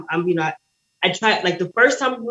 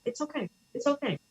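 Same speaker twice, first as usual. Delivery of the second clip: hushed, higher soothing voice; soft reassurance; 7 semitones higher, 11 dB quieter than usual. Retold or imitated. Retelling mom at the visit as everybody cries, soft soothing. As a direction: gently imitate mom comforting everyone, very softly. Retold mother soothing crying family.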